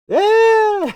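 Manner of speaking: whooping high, loud yeah; a burst of glee, shouted; excited cheer. Real, not acted. Laughing with students, a loud high excited cheer.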